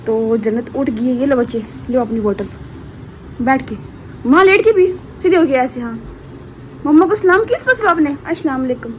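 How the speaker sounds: sing-song mummy voice. Real, not acted. Mother coaxing her baby daughter.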